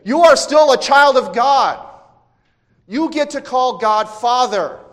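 high, very loud proclamation; shouting. Acted. The Spirit shouting over Satan's temptations, full shout. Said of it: "no, he's saying".

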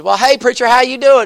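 high, loud hearty greeting; country mother hollering hello; cheerful Mama Swan. Acted. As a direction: call out a bright welcome at the door.

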